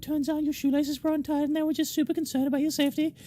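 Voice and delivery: high little voice, rattling off odd nightmares; chirpy newcomer witch. Acted. New witch tells the security lads her favourite nightmares, high-pitched and chatty.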